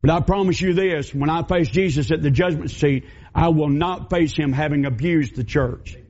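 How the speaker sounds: ordinary talk, nothing marked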